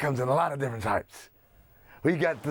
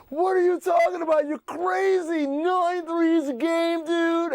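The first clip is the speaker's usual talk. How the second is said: mock disbelief; 13 semitones higher than usual. Everyone mocking the three-point idea.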